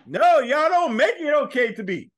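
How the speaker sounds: loud, slow, high complaint; loud protest. Real, not acted. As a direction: shout it back at them, slowly. Heated fan argument, protesting.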